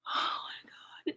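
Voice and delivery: whispered through held-in laughter; stifled laugh. Real, not acted. Host cracking up at the clip: whispered, stifled laugh. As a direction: breathe it out, barely holding the laugh in. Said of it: "not a laughing moment".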